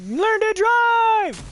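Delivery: very high, drawn-out mock shout; mock angry yell. Mom lifting a taxi off her baby, mock furious yell. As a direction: yell it like an outraged mom at a driver. Mom yelling at the driver, acted.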